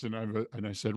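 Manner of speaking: ordinary talk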